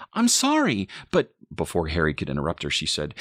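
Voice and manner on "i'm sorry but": very high, louder apology; flustered apology. A narrator's reading. Mother apologizes to angry boyfriend, high flustered voice.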